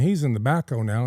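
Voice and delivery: usual speaking voice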